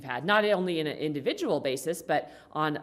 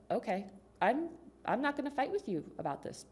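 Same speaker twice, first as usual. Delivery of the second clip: soft, higher, patient reply; calm refusal; 5 semitones higher, 5 dB quieter than usual. Acted. Wife not gonna fight with husband, calm and soft. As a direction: stay gentle and refuse the bait.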